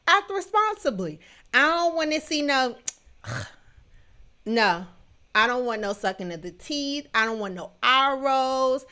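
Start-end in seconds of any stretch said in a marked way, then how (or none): none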